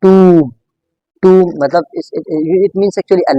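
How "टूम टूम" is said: "टूम" with a long oo, falling; drawn-out, emphatic "टूम"; correct pronunciation. Shown as a demonstration. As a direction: model "टूम" firmly, vowel held. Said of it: "accurately आप इसको किस तरह pronounce करोगे".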